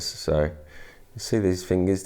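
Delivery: ordinary talk, nothing marked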